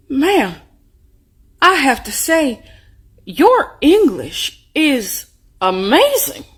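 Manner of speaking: high, very slow, amazed compliment; imitating an earnestly impressed official; impressed clerk. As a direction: draw out each phrase in mock awe. Retold clerk's misguided compliment.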